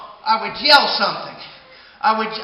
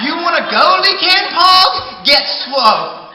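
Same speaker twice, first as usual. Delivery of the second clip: high, loud taunt, dragged out; challenging shout; 6 semitones higher, 7 dB louder than usual. Acted. Man calling out the pecan log before the brawl. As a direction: dare him to fight, loud and high. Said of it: "And I said".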